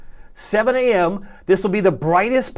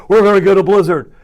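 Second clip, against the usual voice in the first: mock hype; 8 dB louder than usual. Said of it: "somebody told you that online".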